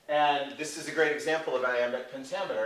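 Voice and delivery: ordinary delivery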